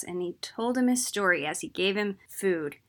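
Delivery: ordinary delivery, nothing marked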